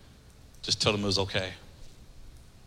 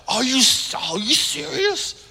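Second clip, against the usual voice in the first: higher, slowed, stunned are you serious; surprised disbelief; 9 semitones higher, slower than usual. Retold or imitated. Homeless man stunned at the offer of a meal, disbelieving. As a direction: let the shock stretch the words out.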